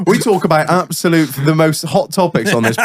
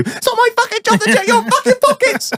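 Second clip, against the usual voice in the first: indignant outburst. Real, not acted. Indignant reply to partner.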